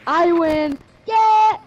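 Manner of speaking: loud gloating victory yell; triumphant shout. Real, not acted.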